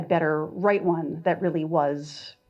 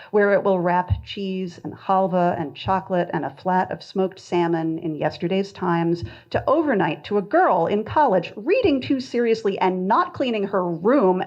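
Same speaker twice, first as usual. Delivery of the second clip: ordinary talk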